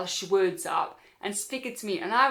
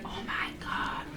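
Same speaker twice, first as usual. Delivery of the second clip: hushed, gossipy whisper of onlookers; imitated crowd whispering in disbelief; shocked whisper; 3 semitones higher, 7 dB quieter than usual. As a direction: whisper it to the person beside you, scandalised.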